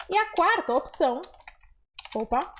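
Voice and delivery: normal talking voice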